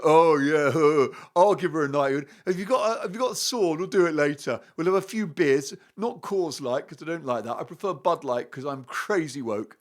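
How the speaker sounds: mocking, chuckling oaf impression; Harry. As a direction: send him up as casually eager, with a laugh at the start. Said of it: "Instead, Harry's like".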